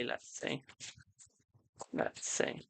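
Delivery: breathy whisper while reading the cards; whispered to herself. Real, not acted. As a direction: whisper it under your breath, consulting. Psychic consulting the cards.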